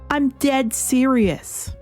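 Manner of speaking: normal talking voice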